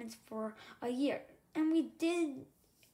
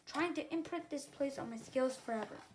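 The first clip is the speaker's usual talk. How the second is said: usual speaking voice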